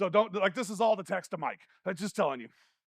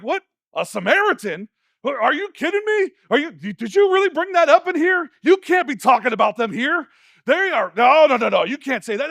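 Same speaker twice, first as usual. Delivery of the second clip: loud, higher, incredulous protest; acted outrage; 4 semitones higher, 9 dB louder than usual. Crowd reacting to a hated Samaritan's mention, acted loud outrage. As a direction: object hard, as if scandalised. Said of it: "They're like".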